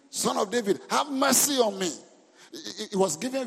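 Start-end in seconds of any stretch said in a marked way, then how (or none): 0.1-1.9 desperate cry for mercy, rising higher and louder; acted scream. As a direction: call out loudly as if begging to be heard. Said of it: "Bible said, he screamed".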